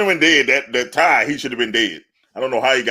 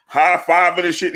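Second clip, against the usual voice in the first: normal talking voice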